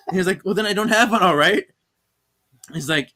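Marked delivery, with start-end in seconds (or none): 0.4-1.6 loud, high defensive snap; hammed-up husband snapping back; mock defensive outburst. Henry snapping back at Paige, loud.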